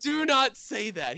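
high, slow stern plea; a teen begging her dad, high and slow; mock urgent warning. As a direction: draw it out high and scandalised.

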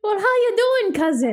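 high, lilting put-on Irish greeting; hammed-up Irish brogue; mock Irish accent. Host greeting her cohost in a hammed-up Irish accent.

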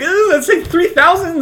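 high, loud laughing panic; mock panic. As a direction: squeal the number in mock horror. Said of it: "I'm like".

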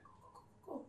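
dad in hospital urging her to go, whispered; weak dying voice. Retold or imitated. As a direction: barely breathe the words out. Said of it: "his voice started to go weaker".

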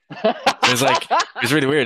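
high, laughing through the words; real laughter breaking into the line; giggling, loud and high. Real, not acted. Laughing while retelling awkward story.

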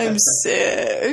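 mocking whiny. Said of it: "woman doesn't say they're sick over and over".

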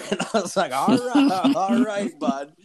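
high, laughing, amused; laughing through it. Real, not acted. Teller laughing to his friend as he retells the standoff. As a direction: crack up while saying it.

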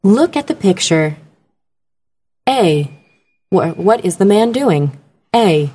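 measured listening-exam narration; deliberate educational CD voice; test-recording reader. Shown as a demonstration. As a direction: read exam choices with careful pauses between them. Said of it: "you hear the person telling the test answers".

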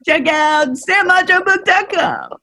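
high, loud TV pitchman; infomercial voice. Shown as a demonstration. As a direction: sell it like a late-night ad. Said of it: "I'm gonna start using my infomercial voice".

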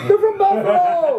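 high, excited holler; emphatic exclamation. Real, not acted. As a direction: cry it out high, as if that settles it. Repeats it louder, laughing.

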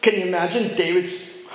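ordinary talk, nothing marked

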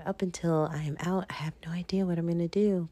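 hushed whisper into the phone. Real, not acted. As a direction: whisper it close to the mic. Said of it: "why I'm whispering into the phone".

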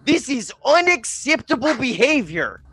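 high, slow, indignant Karen voice; Major Karen Tom. Acted. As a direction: draw out the outraged complaint in a high put-on voice. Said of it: "Major Karen Tom to go stomping".

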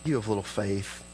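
soft, low and gentle; kind, fatherly. Acted. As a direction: gently chide like a loving father. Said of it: "This is how I see him saying this".

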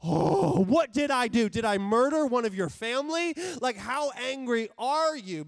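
loud, high, over-dramatic dismay; hammed-up distraught protest; mock alarm. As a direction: overreact as if horribly accused. Panicking over a one-letter reply.

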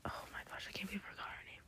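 very soft, whispered disbelief; hushed dismay. Real, not acted. Hushed dismay at forgetting a name.

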